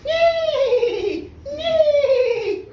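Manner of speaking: high, loud whinny; horse neigh. Acted. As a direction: neigh shrilly like an angry horse. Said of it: "he turned into a horse".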